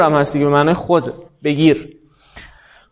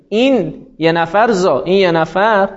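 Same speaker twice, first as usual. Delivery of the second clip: normal talking voice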